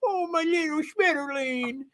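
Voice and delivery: high cooing, as if schmooshing a puppy; puppy-loving coo. Shown as a demonstration. Streamer shows the wrong way, schmooshing a puppy, cooing lovingly. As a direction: gush over a beloved little puppy. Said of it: "Not that you love the puppy".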